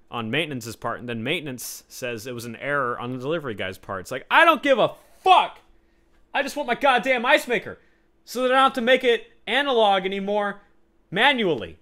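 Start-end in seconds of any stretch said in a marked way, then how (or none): 4.3-11.8 high, loud, fed-up outburst; exasperated rant. Real, not acted. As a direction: vent the frustration loudly. Ranting about ice maker.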